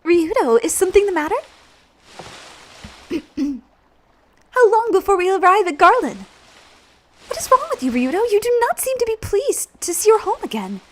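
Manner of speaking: high, put-on heroine voice; concerned young heroine. Voices Elena in game dialogue.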